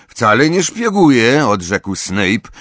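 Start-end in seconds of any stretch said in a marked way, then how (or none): 0.1-1.5 high, loud protest; defensive raised-voice retort; indignant denial. A narrator's reading. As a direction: deny it hotly, voice pitched up. Boy denies spying when accused.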